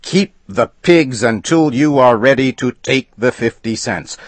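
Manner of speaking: high, loud threatening outburst; angry threat. A narrator's reading. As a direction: threaten him with the law. Customer threatens the agent.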